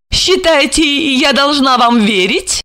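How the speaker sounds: slow, high teasing question; playful. A narrator's reading. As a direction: tease him playfully. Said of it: "игриво поинтересовалась". (игриво)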